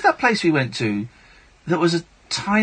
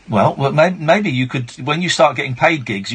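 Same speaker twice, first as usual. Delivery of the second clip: usual speaking voice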